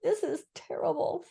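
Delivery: high, fretful little voice; distressed Piglet. A narrator's reading. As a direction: fret in a small creature's voice.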